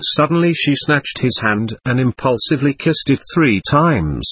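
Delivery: ordinary delivery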